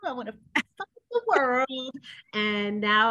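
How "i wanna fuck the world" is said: playing her cranky waking self; grumpy morning self. Waking up cranky, mock-griping to the world. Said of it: "I really did say".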